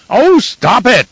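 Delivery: high, loud strained shriek of frustration; exasperated shriek. A narrator's reading. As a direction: squeal it in helpless rage. Baron exasperated by crying baby.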